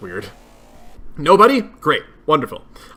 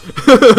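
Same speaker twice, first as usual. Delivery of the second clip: short loud laugh; 7 semitones higher, 8 dB louder than usual. Real, not acted. Laughs at banning himself.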